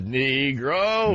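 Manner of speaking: very slow, drawn-out vowels; mock professor. Host giving an instant replay of the professor, mock drawn out. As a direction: stretch the word like a film lecturer.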